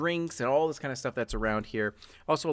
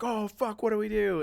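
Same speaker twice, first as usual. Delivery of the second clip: high, raised panicky cry; panicked cry; 10 semitones higher, 3 dB louder than usual. Acted. Partygoers finding the overdosed star, panicking. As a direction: blurt it out in fear. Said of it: "they're like".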